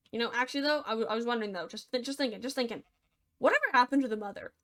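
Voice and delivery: ordinary delivery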